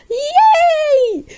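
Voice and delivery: high-pitched, held squeal of joy; high cheer. Pretend. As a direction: squeal a long celebratory yay. Celebrates winning the award.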